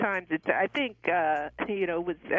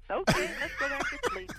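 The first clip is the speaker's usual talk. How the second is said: laughing high through the words. Real, not acted. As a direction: giggle it out, carefree. Jokes about waking up free.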